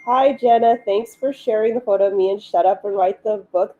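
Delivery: normal talking voice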